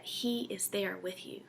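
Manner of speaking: hushed, reassuring; tender whisper. Real, not acted. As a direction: whisper it as a promise. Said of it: "tender reassurance to listeners".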